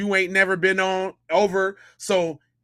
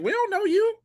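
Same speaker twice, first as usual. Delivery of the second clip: higher mock of the family's cold reply; a hint of a dismissive family voice; 12 semitones higher, 3 dB quieter than usual. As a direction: say it high as if brushing him off. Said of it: "his family's like".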